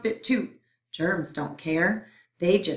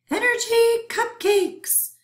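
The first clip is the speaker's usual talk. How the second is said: high, slow, greedy germ voice; hungry germ voice; 5 dB louder than usual. Acted. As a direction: drool over the word cupcakes. Germs craving the cupcakes.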